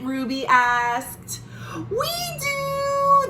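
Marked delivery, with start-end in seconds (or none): 1.9-3.3 put-on very high, eager chorus of kids; eager boys. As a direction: shout it back like happy brothers.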